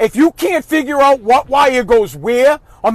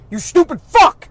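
angry outburst; 8 semitones higher, 5 dB louder than usual. Real, not acted. Angry rant at lazy parents.

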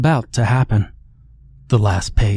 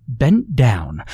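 plain reading voice

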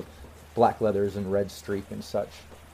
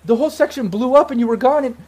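high, loud, disbelieving outburst; freaking out; 14 semitones higher, 7 dB louder than usual. Acted. Ex-girlfriend finds lost love alive, freaking out at high pitch. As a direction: stammer it out, overwhelmed with shock. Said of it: "she just freaks out".

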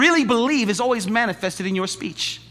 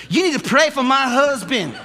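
higher, louder pleading with a laugh; mock pleading church lady; imitating the lady again; 7 semitones higher, 4 dB louder than usual. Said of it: "That's exactly how she says it".

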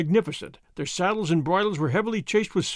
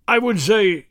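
interjecting; 2 semitones higher, 6 dB louder, slower than usual. A narrator's reading. Governor tries to interrupt the leader.

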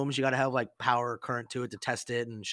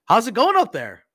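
loud, high call up to someone on a roof; 13 semitones higher, 13 dB louder than usual. Retold or imitated. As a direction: shout up to the guy on the building with mock interest. Master chief calls up to seaman.

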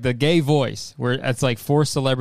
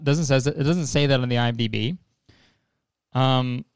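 usual speaking voice

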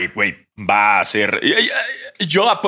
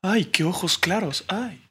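high, soft, lovestruck gushing; swooning referee; 8 semitones higher, 4 dB quieter, much slower than usual. Retold or imitated. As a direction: gush over him like a smitten fan. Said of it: "el árbitro se puso nervioso y dijo".

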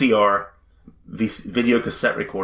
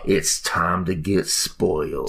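sultry announcer voice; 5 semitones lower, much slower than usual. Pretend. Mock sultry announcer line.

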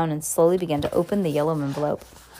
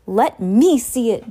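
louder, slow bratty demand; 4 semitones higher, 6 dB louder than usual. Acted. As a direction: insist on seeing it. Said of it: "demanded Dudley".

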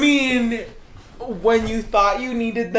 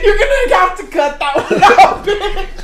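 laughing; 11 semitones higher, 8 dB louder than usual. Real, not acted. Laughing, asking editor to cut.

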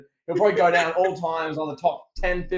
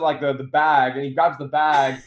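usual speaking voice

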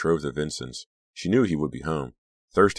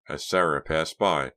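ordinary delivery

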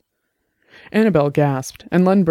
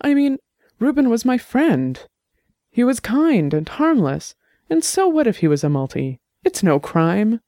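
high, nervous defensive voice; a man squirming under accusation, pitched up; flustered suspect; 7 semitones higher than usual. A narrator's reading. Suspect pleading to investigator, high and flustered.

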